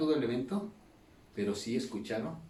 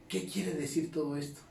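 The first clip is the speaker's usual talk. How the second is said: mildly puzzled, higher-pitched question; perplejos imitados; 4 semitones higher than usual. Retold or imitated. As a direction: voice a baffled crowd member. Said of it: "Y perplejos". (pregunta perpleja imitada, algo aguda)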